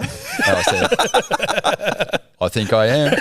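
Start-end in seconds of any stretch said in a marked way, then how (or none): 2.4-3.2 higher, chuffed mock reply; cocky self-mockery. Surfer answering a supposed fan, mock cocky and chuffed. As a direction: play up being convinced you've been recognised. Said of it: "I had a little head wobble on".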